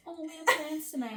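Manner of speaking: a hint of sweetly fake apology; lightly voiced fake friends making excuses; mock fake friends. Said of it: "fake friends".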